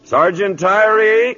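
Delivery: high, drawn-out call across camp. Acted. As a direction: summon the sergeant from a distance. Captain calls for the sergeant.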